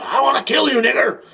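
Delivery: imitating an angry hate-mail writer; mock hate-mail threat. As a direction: bark it like a hateful emailer. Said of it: "shit like that".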